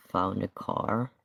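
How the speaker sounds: usual speaking voice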